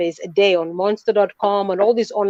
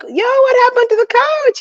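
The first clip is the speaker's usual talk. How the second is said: naive high squeak. Retold or imitated. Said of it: "I was like".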